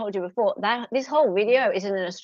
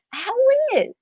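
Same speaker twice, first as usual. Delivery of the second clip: much higher, squealed how weird; squeaky disbelief; 13 semitones higher than usual. Real, not acted. Incredulous reaction.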